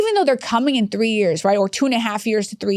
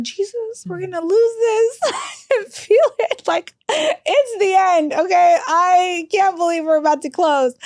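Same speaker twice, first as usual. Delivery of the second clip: half-laughing, hammed-up crying, pitched much higher; mock panic; 8 semitones higher, slower than usual. Retelling her panic during the survey, in mock tearful despair. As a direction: wail in comic despair, as if it's all over. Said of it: "And I'm like".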